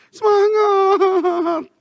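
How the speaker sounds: stretched, sobbing cry of encouragement; crying shout. Retold or imitated. Father crying out encouragement to his children, sobbing shout. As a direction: shout it through tears, long and high. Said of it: "Sambil air mata mengalir".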